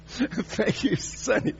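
laughing through a put-on little old lady voice; imitating the old lady while cracking up; old lady thanks. The old woman thanks the preacher, imitated laughing. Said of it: "and she says".

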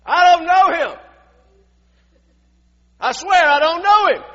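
high, full-force acted shout; shouted denial. Peter swears he doesn't know Jesus, high shouted denial. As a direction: bellow the denial as an oath. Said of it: "began to call down curses on himself".

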